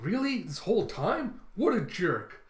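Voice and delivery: high, imitated incredulous questions; indignant disbelief. Captain learning comrade was a spy, high incredulous voice. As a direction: react as if just let in on it. Luffy reacting to betrayal.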